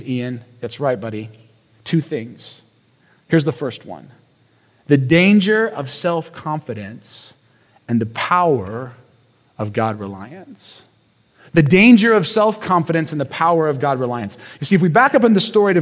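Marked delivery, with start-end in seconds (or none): 4.9-10.8 slow, softening statement. Shown as a demonstration. First statement of the point.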